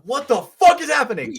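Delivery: high, loud startled yell; scared outburst. Retold or imitated. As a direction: replay your own shocked yell, breaking into a laugh. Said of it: "Scared the shit out of me".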